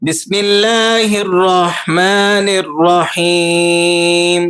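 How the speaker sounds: slow, melodic tilawat; Quranic recitation. Shown as a demonstration. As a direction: recite slowly with tajweed. (قرآنی تلاوت کا انداز)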